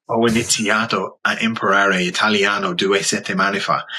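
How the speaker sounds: put-on Italian butchered in thick Dublin accent; worst Dublin accent. Beginner pranking Italians in the photo studio, worst Dublin accent. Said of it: "worst Dublin accent, I will butcher".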